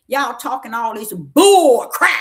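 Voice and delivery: slow rebuke bursting loud at the end; loud scolding. Retold or imitated. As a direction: blast BULL CRAP loud and drawn out. Retold scolding of the woman.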